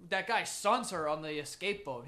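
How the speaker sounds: normal talking voice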